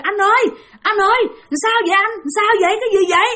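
high, worried calls to his brother; alarmed brother. Retold or imitated. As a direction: rush in, frightened for him. (em trai hốt hoảng gọi anh)

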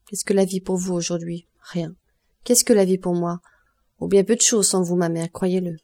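ordinary delivery

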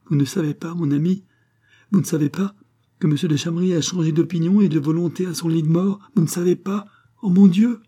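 high, urgent, breaking-news excitement; overjoyed. A narrator's reading. Marquise revealing her husband's change of will, overjoyed. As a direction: build to an overwhelmed exclamation. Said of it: "poussa un cri de joie".

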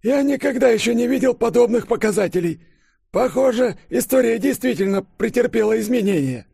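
high, loud, strained professor voice; agitated old professor. A narrator's reading. Flustered professor bursting in with alarming readings, high and agitated. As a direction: report in high-pitched alarm. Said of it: "заикаясь, пролепетал".

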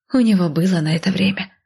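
plain reading voice

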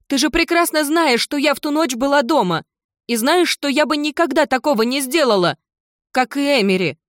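high, loud, indignant pleading; a daughter shouting her innocence; crying out. Acted. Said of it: "закричала Кендалл". (отчаянно кричит)

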